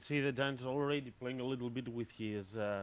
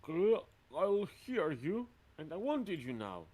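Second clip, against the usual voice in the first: hill giant; 7 semitones higher, 5 dB louder than usual. Acted. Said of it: "the hill giant is gonna".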